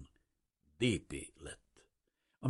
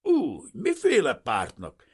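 loud, raised, suspicious question; growled challenge; 8 semitones higher, 10 dB louder than usual. A narrator's reading. Unfriendly official questioning the refugee, growling. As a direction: snap at him suspiciously. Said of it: "felhúzta a szemöldökét és rámordult".

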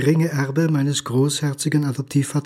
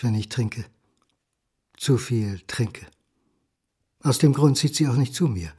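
usual speaking voice

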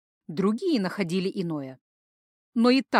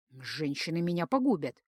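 quiet inner thought; 4 semitones lower, 6 dB quieter than usual. A narrator's reading. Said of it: "подумал Джейка".

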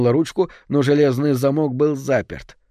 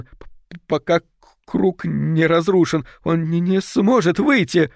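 stammering; 5 semitones higher than usual. Acted. Finchley stutters trying to calm everyone, stammering. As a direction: trip over every syllable. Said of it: "заикаясь".

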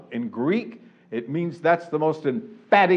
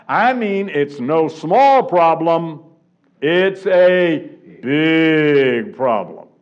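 "no small problem" and "big problem" slow and louder; slow emphatic stress; 5 dB louder, much slower than usual. Shown as a demonstration. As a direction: land each word slowly and hard. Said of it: "I'm stating it negatively".

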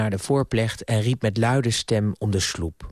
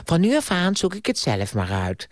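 higher-pitched mocking echo; 6 semitones higher than usual. A narrator's reading. Niece throwing her uncle's ultimatum back, mocking. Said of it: "onderbrak Ardita hem spottend".

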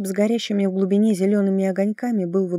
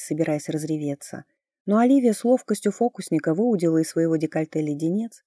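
usual speaking voice

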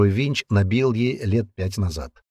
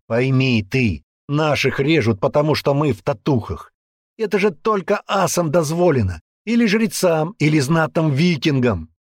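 raised, urgent explaining voice; insistent man; 6 semitones higher, 4 dB louder than usual. Acted. Man explaining the tattoos to the girl, urgent insistence. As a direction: press the theory home earnestly.

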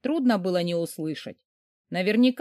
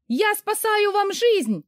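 high, loud, slow yell; 12 semitones higher, 4 dB louder, slower than usual. A narrator's reading. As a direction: shout it while struggling. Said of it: "проорала я".

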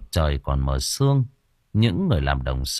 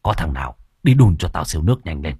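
lower, urgent commands; an old healer barking orders at the lads; low hurried commanding voice; master giving orders; 4 semitones lower, faster than usual. A narrator's reading. Master groans and gives orders.

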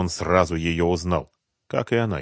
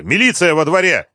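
loud, high urgent warning; shouting; 9 semitones higher, 7 dB louder than usual. A narrator's reading. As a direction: shout a warning to your mate. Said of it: "закричал Малявка".

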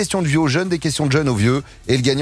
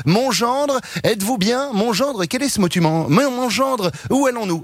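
higher fussy father-in-law voice; father-in-law calling out; 8 semitones higher than usual. Acted. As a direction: call out like a pestering father-in-law. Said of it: "qui a le chic de me crier".

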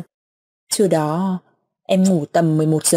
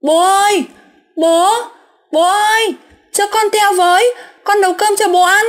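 much higher, louder desperate calling; crying out along the river; 12 semitones higher, 3 dB louder than usual. Retold or imitated. Daughter running along river calling to father, loud anguished cries. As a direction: shout after the boat, pleading. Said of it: "Chỉ biết khóc chạy dọc sông kêu".

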